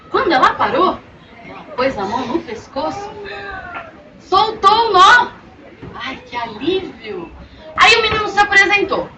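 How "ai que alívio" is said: relieved sigh. Acted. Untying the knot, relief.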